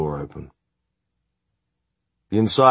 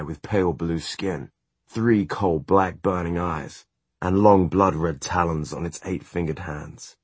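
plain reading voice

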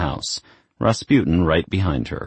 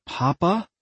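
gasped; 12 semitones higher than usual. A narrator's reading. Daughter hearing her father's ghost speak, gasping in surprise. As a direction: gasp it out, pitch lifting sharply. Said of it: "Isabel gasped".

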